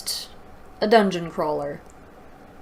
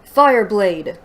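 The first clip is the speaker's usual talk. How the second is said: louder, a game move name shouted; called-out attack; 8 dB louder than usual. Acted. Reader calling out the sword's attack name, loud. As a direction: yell it like an attack call. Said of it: "We're calling out attacks now!".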